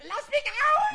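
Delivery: laughing high demand. Acted. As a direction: squeal to get out. Pumuckl trapped, demanding release.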